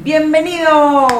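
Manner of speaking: alto, fuerte y alargado, con alegría; un gran saludo de radio, muy lento y festivo; bienvenida entusiasta. Real, not acted. La locutora da la bienvenida. (la locutora saluda a los estudiantes con gran entusiasmo)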